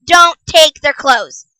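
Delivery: each word of "Don't take their clothes" stressed slowly; emphatic warning. Real, not acted. Vlogger warning bad parents, emphatic. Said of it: "I can't even stress this enough".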